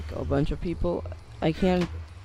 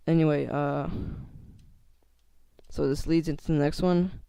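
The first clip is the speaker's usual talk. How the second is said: ordinary talk, nothing marked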